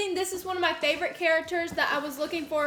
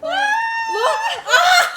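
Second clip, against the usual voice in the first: shocked shout; 9 dB louder than usual. Real, not acted. Shocked at huge gift.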